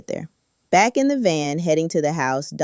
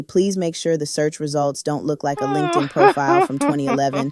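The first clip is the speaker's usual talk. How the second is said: ordinary delivery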